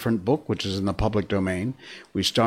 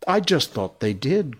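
put-on higher, eager child interrupting; excited; 6 semitones higher than usual. A narrator's reading. Curly breaking into Wendy's story, excited. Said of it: "Curly broke in excitedly".

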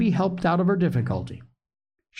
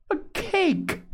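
put-on much higher, startled old woman's cry; surprised exclamation. A narrator's reading. Grandma hearing about the cake, high surprised exclaim. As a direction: squeak it out in delight. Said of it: "exclaimed Grandma".

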